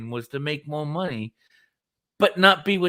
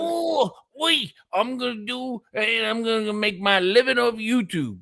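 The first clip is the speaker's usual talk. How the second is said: high-pitched mock Australian guest; Australian impression; 7 semitones higher than usual. Mocking the guest's plan to live off YouTube, mock Australian accent. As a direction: mimic the Aussie in a raised pitch. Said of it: "he was from Australia".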